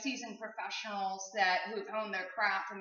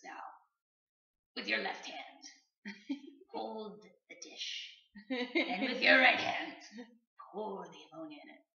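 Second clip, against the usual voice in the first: psychic giving instructions. Retold or imitated. Voices the psychic's solemn instructions.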